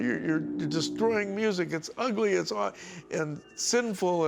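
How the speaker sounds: high-pitched mock tirade, words drawn out; ranting accuser. Storyteller quotes attacker on destroyed music, retold rant. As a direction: imitate someone piling up accusations. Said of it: "and he went on and on".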